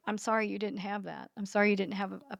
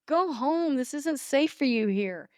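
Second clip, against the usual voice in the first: imitating son's upset plea, pitched up; retold ashamed anger; 6 semitones higher than usual. Mother imitates son found using drugs, upset higher-pitched plea. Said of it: "he would be ashamed and embarrassed and angry".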